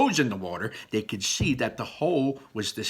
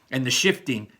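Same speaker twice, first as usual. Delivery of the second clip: usual speaking voice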